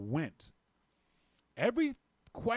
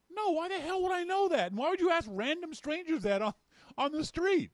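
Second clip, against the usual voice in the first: much higher, exasperated disbelief; why would I know that, pitched way up; incredulous outburst; 13 semitones higher, 3 dB louder than usual. Retold or imitated. Inner reply to the stranger, high and incredulous.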